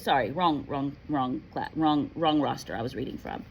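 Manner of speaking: usual speaking voice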